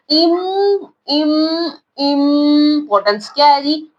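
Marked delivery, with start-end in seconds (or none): 0.1-1.7 "इम" alone, held on a long hum; first syllable isolated. Shown as a demonstration. Teacher has pupils notice the sound, drawn-out "इम". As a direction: draw out "इम", pausing after it. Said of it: "आपने ये देखना".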